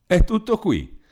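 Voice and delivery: high, scoffing cry of relief; relieved exclamation. A narrator's reading. Carolina scoffing at her brother's fuss, high and relieved. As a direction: throw up her hands in disbelief. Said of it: "esclamò Carolina alzando le mani".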